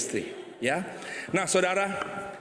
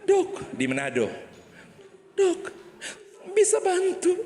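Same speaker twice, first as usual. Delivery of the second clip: high pleading woman; 14 semitones higher than usual. Acted. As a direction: plead up high like a desperate wife. Wife pleading to doctor. (istri memohon ke dokter dengan suara tinggi)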